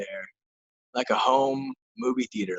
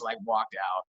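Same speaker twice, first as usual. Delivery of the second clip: normal talking voice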